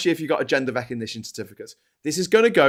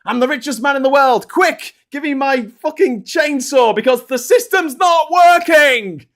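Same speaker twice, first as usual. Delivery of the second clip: louder, higher whiny rich man demanding his chainsaw; mocking Elon Musk; 10 semitones higher, 6 dB louder than usual. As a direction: ham up a spoiled billionaire shouting for his chainsaw. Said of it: "and he's like".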